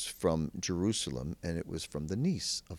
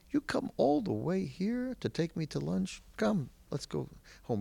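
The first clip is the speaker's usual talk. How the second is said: put-on insistent old man; 13 semitones higher than usual. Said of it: "And he says".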